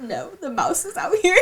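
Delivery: high, laughing reply; laughing reply to the worried sister-in-law; laughing correction. Retold or imitated. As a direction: laugh while setting it straight.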